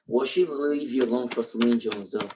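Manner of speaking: normal talking voice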